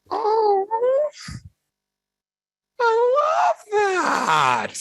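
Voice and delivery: gushing delight. Retold or imitated. Coworker gushing over the temple vow, squealed high. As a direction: swoon over it in a high cry. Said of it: "And she went".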